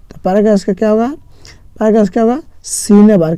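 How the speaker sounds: normal talking voice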